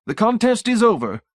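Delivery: high, loud pious verdict; smug technician. Acted. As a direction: pronounce the heathen's defeat.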